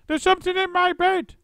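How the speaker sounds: piercing high shriek; terrified wife shrieking; scream. A narrator's reading. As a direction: scream for your life. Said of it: "screamed Missus Twit".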